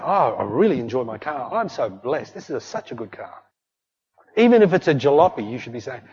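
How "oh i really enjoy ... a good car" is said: soft, grateful murmur; content. Shown as a demonstration. Believer content with his car, soft and grateful. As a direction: savour it quietly, thankful. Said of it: "nothing wrong with saying".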